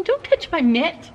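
slowed, lightly voiced toddler line; voicing the baby. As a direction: speak for the baby, slowly. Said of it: "He's saying".

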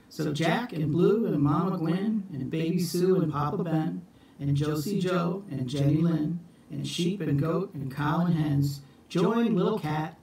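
ordinary delivery, nothing marked

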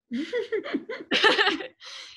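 a high, bursting real laugh at the story; breaking into real laughter mid-story. Real, not acted.

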